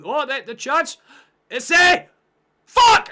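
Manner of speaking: real high-pitched scream at a missed chance; full-volume cry of agonised disbelief; anguished yell. Real, not acted. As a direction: explode in frustration, very loud and high.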